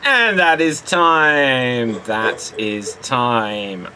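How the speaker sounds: slow, long-held host's call; drawn-out time call. Real, not acted. Host closing the improv round, drawn-out call. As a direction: stretch each word, falling away at the end.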